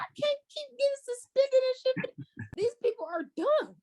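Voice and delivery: high, incredulous mock outburst; mock outraged disbelief. Reading Twitter takes, high incredulous mock outrage. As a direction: act out reading dumb tweets in shock. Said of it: "I'm like".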